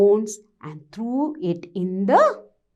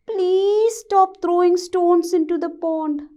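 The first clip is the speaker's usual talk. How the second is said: pleading; 7 semitones higher than usual. Acted. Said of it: "He was requesting, he was pleading".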